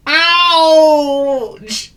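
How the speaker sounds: shrill, loud cry of pain; a goat yelps as he lands in the bath; wailing ouch. Acted. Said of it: "he wailed".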